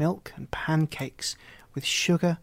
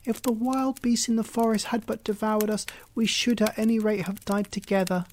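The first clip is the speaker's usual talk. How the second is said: put-on much higher young girl's voice, grieving; high girl's voice lamenting; despairing little girl; 7 semitones higher than usual. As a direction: wish aloud you had died together. Gretel lamenting to God.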